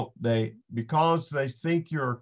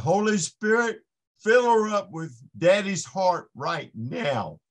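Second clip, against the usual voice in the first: retold fervent prayer; 6 semitones higher, 4 dB louder than usual. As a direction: call on the Spirit with raised voice. Prays aloud over the woman.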